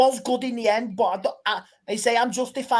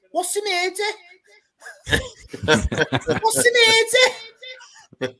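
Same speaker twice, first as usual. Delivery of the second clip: higher, laughing Scouse "What's the murder?"; Scouse criminal; 10 semitones higher, 3 dB louder than usual. Retold or imitated. Retelling a famous Scouse police clip, high mock Scouse accent. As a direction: ham up the arrested Scouser. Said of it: "the criminal".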